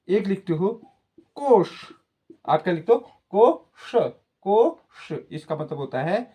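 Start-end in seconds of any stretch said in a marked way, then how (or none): 1.4-1.7 कोश drawn out, higher, ending in a hushing sh; kosh with sha. Shown as a demonstration. Teacher showing pupils the difference between the sibilants, कोश with sh. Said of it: "कितना बड़ा अंतर होता है". 3.3-5.1 कोश split in two, ko then curled-tongue sha, higher; kosh with retroflex sha. Shown as a demonstration. Teacher spelling out the word for treasury, कोश with retroflex sha. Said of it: "कितना बड़ा अंतर होता है".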